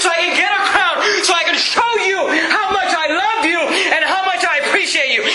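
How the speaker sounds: high-pitched, fervent preaching as Paul; impassioned acted declaration. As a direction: pour it out to Jesus with full fervor. Acting Paul's impassioned declaration to Jesus.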